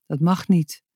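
plain reading voice

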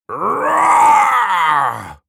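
long rising roar, big and loud; dinosaur roar. Acted. Muk trying a dino roar for Leo, loud roar. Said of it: "deed zijn mond open en".

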